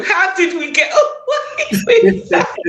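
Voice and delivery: high-pitched, breaking into laughter; laughing disbelief. Real, not acted. Singer amazed they got away with that, laughing.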